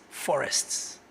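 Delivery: hushed echo. Real, not acted. Speaker mourns lost forests to the company audience, hushed. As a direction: breathe the word forests almost unvoiced.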